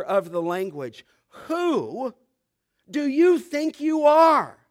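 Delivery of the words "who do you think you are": slow, raised, indignant question; mocking challenge. Preacher voicing the crowd answering Jesus, mocking challenge. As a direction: throw it at him as a drawn-out taunt. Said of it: "They're mocking him".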